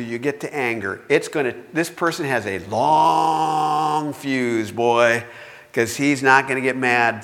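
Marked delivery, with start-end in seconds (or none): 2.7-4.7 LONG stretched far out, slow; long drawn out. Shown as a demonstration. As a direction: draw out "long" as if it never ends. Stretches the word long for effect.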